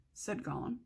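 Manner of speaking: ordinary delivery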